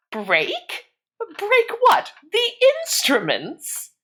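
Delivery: high astonished repeating; puzzled query. A narrator's reading. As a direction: repeat it back, baffled. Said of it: "queried the owner".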